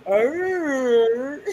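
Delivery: a short imitated growl; mock growl. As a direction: growl it the way the woman did. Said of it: "the woman in front of that says".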